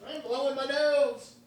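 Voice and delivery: very high, softened squeal; high-pitched whine. Real, not acted. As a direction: call it out in a tiny, sky-high voice. Congested player answering while blowing nose.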